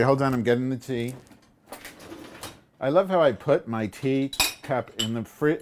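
ordinary delivery

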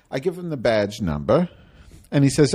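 usual speaking voice

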